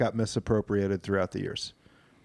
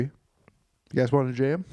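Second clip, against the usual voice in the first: mock Australian. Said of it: "Australian sandstorm".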